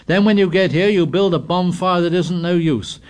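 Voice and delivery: heated tirade. A narrator's reading. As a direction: pour out the grievances.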